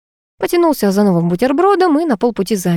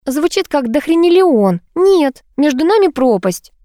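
high, hammed-up lament; melodramatic, drawn out and high; theatrical sigh; 8 semitones higher, 3 dB louder, slower than usual. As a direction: play the tragic young beauty, pitched up. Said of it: "картинно вздохнула Лилька".

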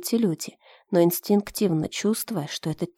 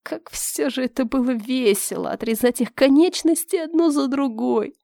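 higher, relishing villain's voice; vile gloating; 5 semitones higher than usual. Acted. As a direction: savour the cruelty with a sneer. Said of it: "Гнусно рассмеялся тёмный хромой".

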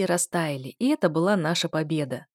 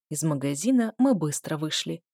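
plain reading voice

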